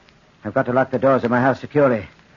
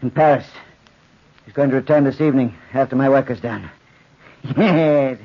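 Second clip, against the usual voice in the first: normal talking voice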